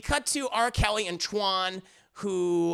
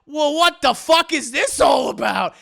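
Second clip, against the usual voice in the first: loud, higher demand; hammed-up "what is going on" shout; mock outraged bafflement; 6 semitones higher, 10 dB louder than usual. Friends burst into the house, loud mock disbelief. Newcomers see the spectacle.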